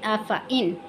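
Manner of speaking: अफ़ाइन with short fa, separate in; correct short vowel. Shown as a demonstration. Teacher gives the correct reading, short fa. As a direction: keep अफ़ाइन brief, no long aa. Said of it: "इसे पढ़ेंगे".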